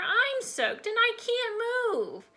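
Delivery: high, slow complaining wail; stuck kid's high-pitched plea; distressed child calling. A narrator's reading. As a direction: draw it out, high and helpless. Trapped child calls out.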